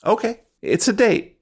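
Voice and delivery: high delighted squeal of agreement; light up, thrilled and girlish; excited. Acted.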